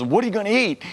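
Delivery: a mild hint of an anxious mother; worried mother. Italian mother reacts on the phone.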